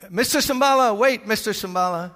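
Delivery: loud, high call after someone leaving. Retold or imitated. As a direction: shout his name so he waits. Ranger calling after him.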